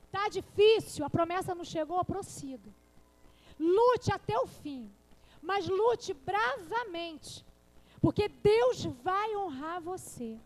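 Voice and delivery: preacher rallying the weary; fervent preaching shout. Acted. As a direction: stretch each word, push it out loud. Preacher urging the church on.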